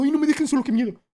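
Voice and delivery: high, loud whiny mock fear; mock panic. Streamer mocking people afraid of being alone, high whiny panic. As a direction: ham up a scared kid begging not to be left. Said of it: "nadie es como".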